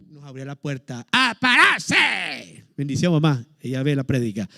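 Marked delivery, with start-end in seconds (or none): 1.1-2.6 high, long drawn-out wake-up call; a mother hauling the kids out of bed. Retold or imitated. Mother waking children for prayer, drawn-out high call.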